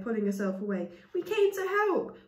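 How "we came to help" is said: pleading. Acted. Chaya pleading with villagers.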